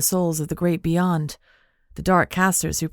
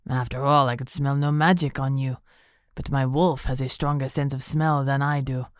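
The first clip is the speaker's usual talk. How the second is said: lower, relishing the danger; sinister villain; 3 semitones lower than usual. Acted. Benito explaining.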